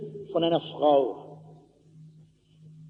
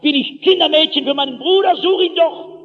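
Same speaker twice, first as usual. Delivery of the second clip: high, fast, insolent retort; patzig; 8 semitones higher, 3 dB louder than usual. Acted. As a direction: fire back cheekily, refusing all blame. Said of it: "Er sagt patzig". (patzig und trotzig, mit hoher Stimme)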